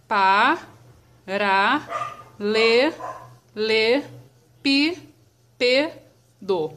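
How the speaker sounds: "paralelepípedo" very slowly, pausing after each syllable; "paralelepípedo" in drawn-out, separated syllables. Shown as a demonstration. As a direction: break "paralelepípedo" into seven separate beats. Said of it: "vamos separar essa palavrinha".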